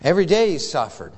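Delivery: ordinary talk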